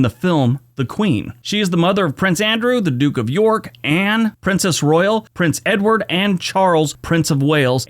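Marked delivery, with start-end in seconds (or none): none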